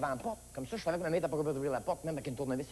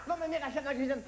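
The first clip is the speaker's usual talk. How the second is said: breakneck-story urgency, pitched up; frantic narration; 6 semitones higher, faster than usual. Acted. Boy recounting mother in the kitchen amid screams, frantic. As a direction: tell it high and panicky.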